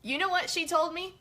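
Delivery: ordinary delivery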